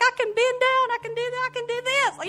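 high, thrilled declarations; excited. Retold or imitated. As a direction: marvel at it, thrilled. Retold joy after healing prayer.

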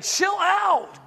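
put-on high, exasperated chill-out at a fussing kid. Parent fed up with a kid fussing over lemonade, exasperated. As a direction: roll your eyes and tell the kid to calm down.

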